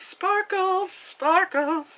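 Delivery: sing-song sparkles. Retold or imitated. Mock magic sparkle.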